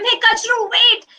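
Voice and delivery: high call to stop a friend leaving; calling after him. Acted. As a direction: call him back before he goes. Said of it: "Matru tried to stop him".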